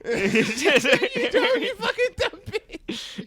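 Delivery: very high, loud, slow crying wail laughing; sobbing insult. Retold or imitated. Crying giant cursing her out, very high sobbing wail. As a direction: wail the insult through fake sobs. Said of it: "while crying".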